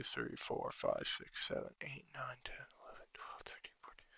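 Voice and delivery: whispered counting fading away; counting under breath. Real, not acted. Counting destroyers under his breath.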